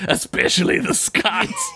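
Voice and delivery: strained Scottish indignation, played for laughs; Willie. Willie on who you can't trust, Scottish suspicion. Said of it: "I love that Willie".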